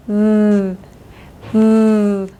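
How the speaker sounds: "v" made as a buzzing TH, held long; tongue between teeth, voiced hum of TH; voiced TH sound. Shown as a demonstration. Teacher showing the last sound, voiced TH. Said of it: "and the last one".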